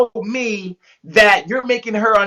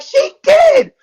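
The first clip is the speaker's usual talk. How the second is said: a much higher, louder She did; insistent exclaim; 6 dB louder than usual. Retold or imitated. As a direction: blurt it back as if it's obvious. Serenity insists in the car.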